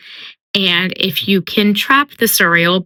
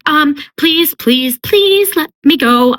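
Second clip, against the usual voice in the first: higher, drawn-out begging; mock terrified pleading; 8 semitones higher than usual. Suriel begging Feyre to let it go, mock terrified. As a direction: beg in a hammed-up panic, stretching each please. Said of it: "increasingly agitated and terrified".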